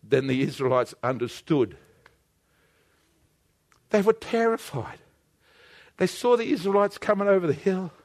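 low, soft suspenseful narration; hushed storytelling. Real, not acted. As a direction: draw listeners in with a quiet tale of dread.

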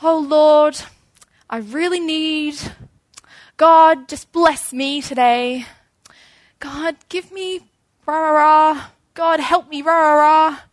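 high, loud, whiny pleading to God; mock demanding prayer. Praying selfishly to God, mock whiny demanding.

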